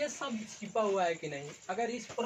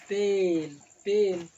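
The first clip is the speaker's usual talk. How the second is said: slow emphatic demo; 9 dB louder, much slower than usual. Shown as a demonstration. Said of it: "पेन उच्चारण देखेंगे".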